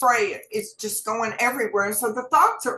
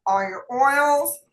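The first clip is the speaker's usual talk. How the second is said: normal talking voice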